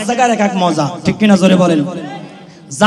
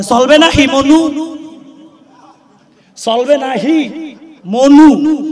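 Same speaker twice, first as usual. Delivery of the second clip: Barishal accent, sung out higher; Barishal dialect; 6 semitones higher, much slower than usual. Shown as a demonstration. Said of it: "এদেরকে বলতে হবে".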